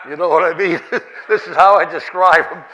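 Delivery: amused at your own story; real laugh breaking into the words. Real, not acted. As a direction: talk through laughter, loud and lifted.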